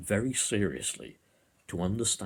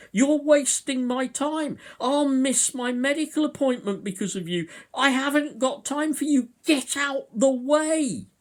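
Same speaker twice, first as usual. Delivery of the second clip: high, loud imitation of an impatient man; mock angry shouting; 8 dB louder than usual. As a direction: shout like a queue-jumper demanding people move. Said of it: "rope in on this and say".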